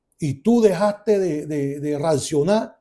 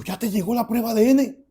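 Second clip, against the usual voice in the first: higher, livelier questioning echo; surprised question; 4 semitones higher than usual. Retold or imitated. Son asks father about the DNA test, surprised. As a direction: ask it back in surprise.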